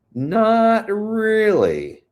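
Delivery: high, loud, long-drawn words; emphatic denial. Real, not acted. Answering a viewer about Insta, emphatically denying. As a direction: stretch it out high and loud, then drop.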